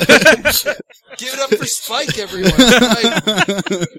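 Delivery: slow, loud MC hype; announcer voice. Pretend. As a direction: announce the act to the crowd. Introducing a comedian.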